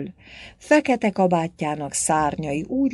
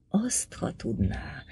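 slow pursed-lip whisper; 7 dB quieter, slower than usual. A narrator's reading. Old priest whispering slyly to the young lady. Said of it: "úgy súgta összecsücsörített szájjal".

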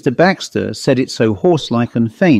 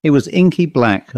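ordinary delivery

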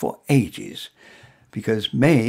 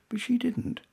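ordinary delivery

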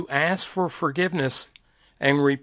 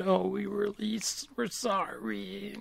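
much softer, whimpering mock plea; mock weepy apology; 4 semitones higher, 10 dB quieter than usual. Preacher mimicking someone crying for forgiveness, softly whimpering. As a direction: imitate a tearful sinner begging, half-mocking.

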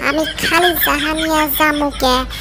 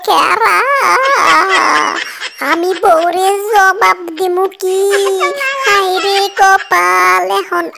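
dismayed wailing; 3 semitones higher than usual. Acted. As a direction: wail it out, stretching the last vowels. Seller finds his bananas gone.

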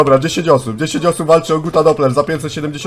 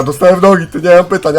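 ordinary talk

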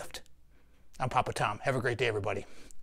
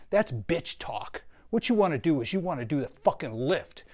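ordinary talk

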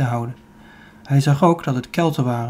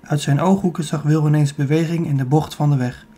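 ordinary delivery, nothing marked

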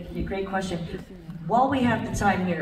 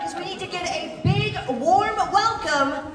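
hyped announcer; 9 semitones higher, 6 dB louder than usual. Acted. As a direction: build the crowd up to the name. Host introduces the star guest.

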